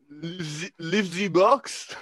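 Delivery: a dubious, German-tinged attempt at French; French accent. Shown as a demonstration. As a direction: put on a halting French accent. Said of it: "in a French accent".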